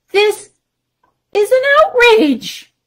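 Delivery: high-pitched indignant little bee; outraged bee. A narrator's reading. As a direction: protest loudly in a high bee voice. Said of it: "buzzed the bee".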